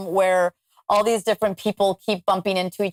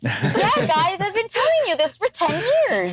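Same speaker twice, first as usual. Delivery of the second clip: higher, laughing and slow, fed up with not being believed; half-laughing, pitched up: come on, I told you; exasperated laughing protest; 11 semitones higher, slower than usual. Retold or imitated. Exasperated retort to doubting friends.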